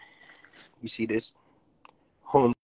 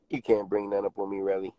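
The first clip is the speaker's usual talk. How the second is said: ordinary talk, nothing marked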